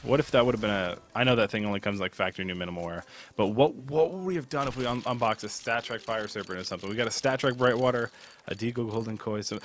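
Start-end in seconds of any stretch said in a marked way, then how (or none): none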